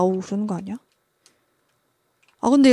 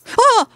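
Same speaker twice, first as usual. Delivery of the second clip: sharp high scream of fright; startled scream; 12 semitones higher, 7 dB louder than usual. Real, not acted. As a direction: scream as if jumped at. Jump scare in horror game.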